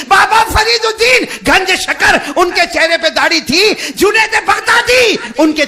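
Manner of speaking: loud, high fervent recital of saints; impassioned shouted sermon; full-force preaching. Acted. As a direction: thunder each name to the crowd.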